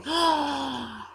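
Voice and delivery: high, breath-held gasp of wonder; boy's awed gasp. Acted. Boy holding a bird on his wrist, awed gasp. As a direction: gasp like a thrilled child. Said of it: "holding on his wrist going".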